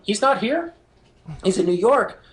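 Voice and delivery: retold disbelief, pitch jumping high; astonished. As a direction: react in loud, high-pitched amazement. Learns announcer is remote.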